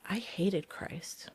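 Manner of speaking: whispered, slow admission; hushed confession. Real, not acted.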